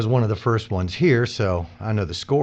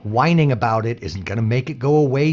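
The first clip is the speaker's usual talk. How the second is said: louder, pushed snap at Jimmy; angry retort; 4 semitones higher, 7 dB louder than usual. Acted. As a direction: shut him down hard. Coworker snaps at Jimmy.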